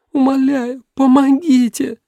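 high put-on girl's plea; pleading girl. A narrator's reading. Girl begs the men for help, high pleading cry. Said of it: "взывал девичий голос".

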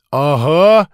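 loud, eager shout; kids' yell. A narrator's reading. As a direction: yell agreement like a bunch of children. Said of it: "завопили ребята".

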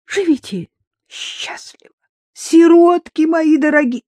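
high, slow, breaking from whisper to wail; sobbing farewell. A narrator's reading. Drunk mother bids children farewell, sobbing. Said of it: "она всхлипнула".

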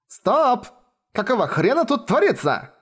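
high-pitched, indignant outburst; exasperated cry. A narrator's reading. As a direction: demand order in a high voice. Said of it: "крикнула я".

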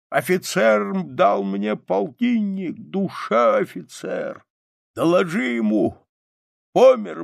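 slow, strained last orders; old soldier's proud last report; dying soldier's command. A narrator's reading. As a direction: give the final order haltingly, rising high.

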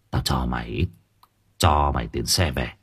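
put-on very low, soft old worker; quietly kind. A narrator's reading. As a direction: offer it quietly, voice dropped low. Old worker offers fare money. (ông già nói khẽ, giọng trầm)

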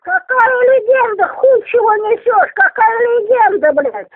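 high, tearful indignant cursing; foul-mouthed granny. Real, not acted. Old woman rejects being called legend. (возмущённая ругань старухи)

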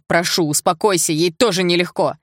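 loud, urgent appeal; shouted plea. A narrator's reading. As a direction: plead loudly with her to calm down. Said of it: "закричал Игорь".

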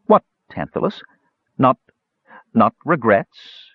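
mocking, sneering taunt. A narrator's reading. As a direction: sneer it at him. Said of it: "she would have sneered".

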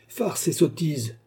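slow, high-pitched scorn; scornful dismissal. A narrator's reading. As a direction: dismiss it slowly and contemptuously. Magistrat rejette la prière d'Oliver. (mépris traînant, voix aiguë)

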